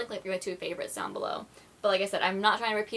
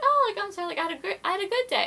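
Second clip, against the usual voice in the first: high-pitched sarcastic apology, much higher; mock apology; 12 semitones higher, 4 dB louder, faster than usual. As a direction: squeak out a fake sorry. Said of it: "And I'm like".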